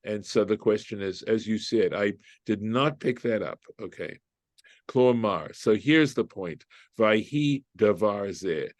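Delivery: normal talking voice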